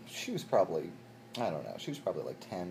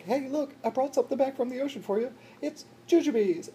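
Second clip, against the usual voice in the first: excited; 5 dB louder than usual. Retold or imitated. Eagerly presenting a gift.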